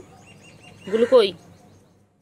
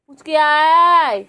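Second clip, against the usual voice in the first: long, slow, loud call to the pet; 5 semitones higher, 6 dB louder, much slower than usual. Real, not acted. Owner calling her pet, slow loud drawn-out call. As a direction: draw the call out slowly across the yard.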